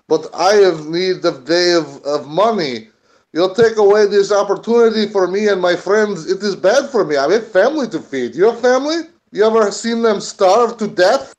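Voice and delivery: put-on Russian-accented worker's plea. Worker pleads for his family's pay, Russian accent. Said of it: "Using my Russian accent".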